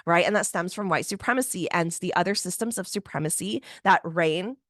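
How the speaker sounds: normal talking voice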